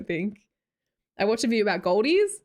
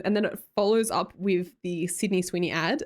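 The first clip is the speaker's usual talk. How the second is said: normal talking voice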